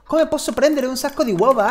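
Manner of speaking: usual speaking voice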